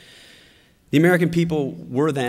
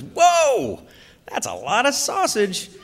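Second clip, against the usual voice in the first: lightly voiced little boy, much higher and louder on the whoa; mock little boy blurt; 7 semitones higher, 6 dB louder, slower than usual. Little son blurting at the huge customer, lightly voiced. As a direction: blurt it out like a wide-eyed kid.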